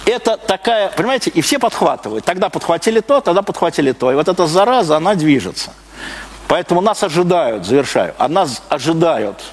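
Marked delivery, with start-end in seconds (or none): none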